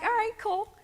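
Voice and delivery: high, casual agreement; lightly voiced mom saying yes; offhand mom; mom's breezy okay. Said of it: "she was like".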